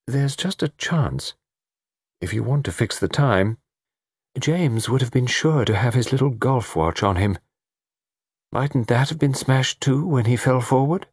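high woman's character voice; lightly voiced hesitant lady; thoughtful lady. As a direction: venture it tentatively, pitched high. Laura suggests the watch.

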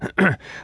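polite cough before speaking; throat clearing. A narrator's reading. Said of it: "Major Riddle coughed".

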